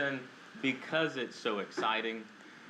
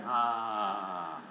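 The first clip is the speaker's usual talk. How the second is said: a long, audible breath-out sigh; demo sigh; 5 semitones lower than usual. Shown as a demonstration. Showing the audience how to release the breath, audible sigh. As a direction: show the room how to sigh it out. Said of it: "letting that out with a little bit of a sigh".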